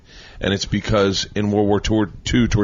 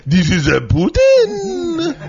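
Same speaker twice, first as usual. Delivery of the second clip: very high, slow hammed-up vampire accent; Putin vampire voice; 4 dB louder, much slower than usual. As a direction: drag it out like a cartoon vampire Putin. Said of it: "he does sound like a vampire".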